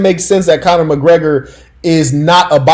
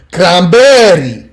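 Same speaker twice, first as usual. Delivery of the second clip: cranberry stretched long and loud in a mock Spanish accent; drawn-out, higher mock Spanish accent; unison Spanish-accent chorus; 4 semitones higher, 5 dB louder, much slower than usual. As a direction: belt it like a whole room of Puerto Ricans answering. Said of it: "And in unison, a room full of".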